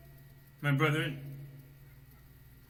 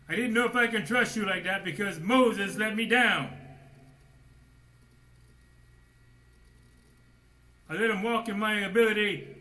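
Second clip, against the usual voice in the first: loud, high preaching as God's voice; preacher booming God's complaint about Moses; full-force preaching; 9 semitones higher, 9 dB louder than usual. Acted. Preacher voicing God to the congregation, loud full-force preaching.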